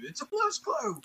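put-on very high little-kid repeat; toddler voice. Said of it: "He just repeats it".

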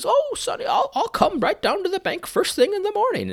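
put-on high quavery-free old lady falsetto; old grandmother. Seinfeld's grandmother on the phone with her bank, high old lady voice. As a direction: lift way up into a sweet old lady's voice. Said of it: "And so she says".

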